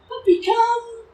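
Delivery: high, loud, bright call; excited recall call. Real, not acted. Trainer calling the puppy after the lead drops, loud excited call. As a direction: call her in with real excitement.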